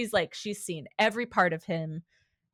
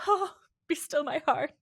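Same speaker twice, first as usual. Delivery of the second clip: high, dreamy fangirl sigh; hammed-up lovestruck gush; mock swoon; 9 semitones higher than usual. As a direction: swoon melodramatically, pitched high. Mock swoon over laughing villain.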